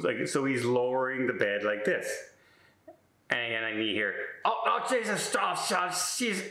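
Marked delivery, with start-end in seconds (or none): 4.4-6.5 put-on high whimpering old man; whiny groan. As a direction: whine the Jesus out high. Imitates roommate groaning.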